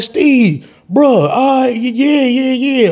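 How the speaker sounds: dazed phone answer. Retold or imitated.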